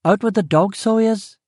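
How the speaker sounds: brisk Belfast housekeeper's lilt; West Belfast cook. Acted.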